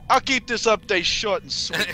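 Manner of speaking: Count voice. Pretend. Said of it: "Why do you sound like Count?".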